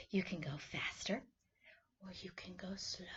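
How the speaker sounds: whisper. Shown as a demonstration. Teacher guiding kids' movement.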